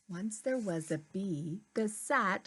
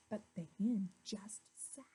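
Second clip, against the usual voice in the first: fast, softer whisper; whispered narration; 7 dB quieter, faster than usual. A narrator's reading. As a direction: whisper it like a secret.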